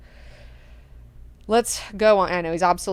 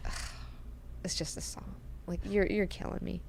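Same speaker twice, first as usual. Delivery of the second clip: very soft, almost whispered despair; exasperated sigh; 14 dB quieter than usual. Retold or imitated. Leto hearing her weak sauce answer, quiet exasperation. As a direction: sigh it out under the breath, defeated.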